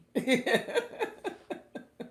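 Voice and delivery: high, loud peal of laughter; hearty laugh. Real, not acted. Admits knowing only few words.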